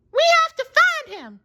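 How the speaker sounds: high determined Texan squirrel voice; rally your friend; Sandy voice. A narrator's reading. Sandy urging Patrick to search. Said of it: "said Sandy".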